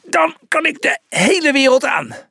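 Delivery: high, strained boast; effortful, high-pitched bravado; groaning with effort. A narrator's reading. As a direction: boast it while straining through exercise. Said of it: "kreunde Julius".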